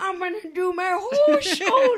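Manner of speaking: a hint of a shaky old man; shaky leprechaun voice. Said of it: "like this".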